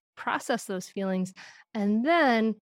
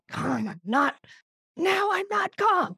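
mock shout; 10 semitones higher, slower than usual. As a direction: blow up on the last words. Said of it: "so then we're like".

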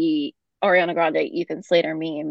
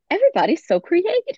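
high sing-song "Everybody's so creative."; quoting her catchphrase; 11 semitones higher, 3 dB louder than usual. Retold or imitated. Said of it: "my response to anything weird or bad is".

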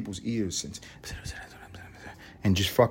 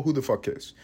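usual speaking voice

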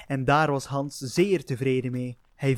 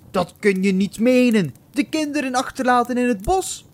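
angry father; 8 semitones higher, 6 dB louder than usual. Acted. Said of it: "riep de boze houthakker".

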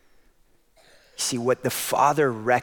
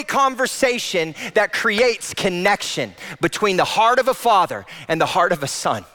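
impassioned sermon climax; full-force preaching; 12 semitones higher, 4 dB louder than usual. Acted. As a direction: lift the hall with fervour.

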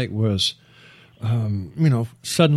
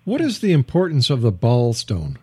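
ordinary talk, nothing marked

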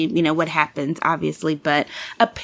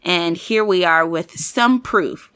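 ordinary talk

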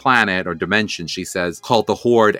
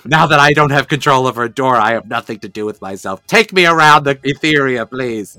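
lightly voiced bored villainess; a hint of a whiny sorceress; Shadow Weaver; 3 semitones higher, 4 dB louder than usual. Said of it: "her Shadow Weaver voice".

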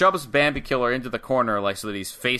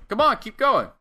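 higher-pitched imitation of a taunt; goading; 10 semitones higher, 4 dB louder than usual. Host voicing wrestler daring his opponent, goading. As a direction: egg them on as a mock challenger.

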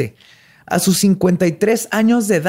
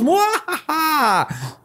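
slow, hammy mock evil laugh; evil cackle; 10 semitones higher, much slower than usual. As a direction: cackle like a cartoon villain. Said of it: "tratando de verse malo".

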